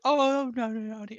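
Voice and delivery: very high squeaky put-on voice; Will impression. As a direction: play it like a frazzled cartoon colleague. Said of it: "that's a good Will impression".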